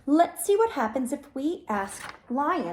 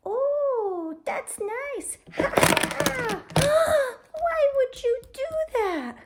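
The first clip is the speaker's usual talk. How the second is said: upset turtle; 9 semitones higher, much slower than usual. Acted. Turtle upset, toys taken.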